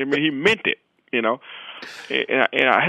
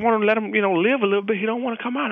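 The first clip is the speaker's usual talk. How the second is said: higher, earnest explanation; friend explaining; 7 semitones higher than usual. Retold or imitated. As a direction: explain it like a sincere pet owner.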